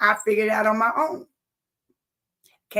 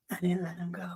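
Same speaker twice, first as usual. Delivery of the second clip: lower, whispered confession; 4 semitones lower, 12 dB quieter than usual. Real, not acted. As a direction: whisper it like a secret admission. Confessing aside after vow.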